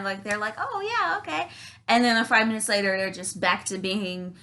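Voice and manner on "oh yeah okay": high, light mock-pleased voice; feigned agreement. Narcissist answering praise of a clean room, high feigned agreement. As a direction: go up high, noncommittal.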